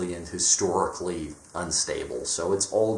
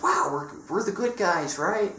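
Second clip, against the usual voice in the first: high, falsely cheery mock of a proud dad; mocking Pete Hegseth; 10 semitones higher than usual. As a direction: pitch it way up, smug and naive. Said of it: "He, he tries and says".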